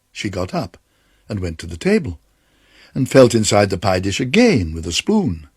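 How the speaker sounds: ordinary delivery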